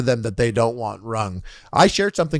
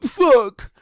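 much higher, strangled little curse; squeaky mock curse; 12 semitones higher, 4 dB louder than usual. Streamer reliving the moment viewers heard him, high-pitched mock panic.

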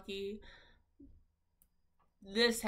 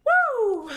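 high, loud whoop; 14 semitones higher, 14 dB louder than usual. Real, not acted. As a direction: let out a shrieked "woo". Own whoop in game.

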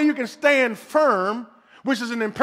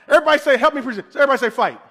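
call and response; 2 semitones higher, 4 dB louder, much faster than usual. Real, not acted. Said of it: "preacher leading congregation response".

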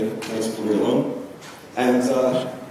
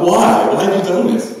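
high, loud anguished cry; 8 semitones higher, 8 dB louder than usual. Acted. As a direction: cry out why in despair. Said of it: "cries out".

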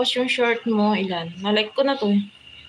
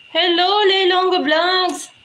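excited greeting; 7 semitones higher, 5 dB louder, slower than usual. Real, not acted. Greeting a viewer on stream.

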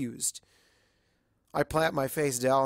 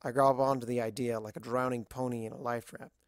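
ordinary delivery

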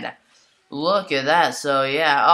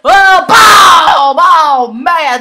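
excited shout; 11 semitones higher, 14 dB louder, much slower than usual. Real, not acted. Knockout blow lands.

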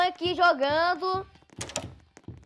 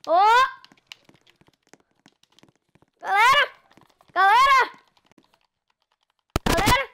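calling out; 7 semitones higher, 7 dB louder than usual. Real, not acted. Game bugged, calling the audience.

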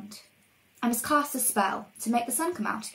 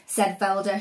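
plain reading voice

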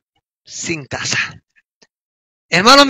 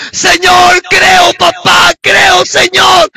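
high, drawn-out shouted plea; retold desperate cry; 6 semitones higher, 4 dB louder, much slower than usual. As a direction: act out a wife crying out her belief to God. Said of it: "Mi esposa recién grita". (grito de súplica, imitado)

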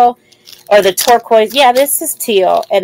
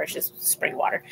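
whispered aside; 14 dB quieter than usual. Real, not acted. Aside while drinking water.

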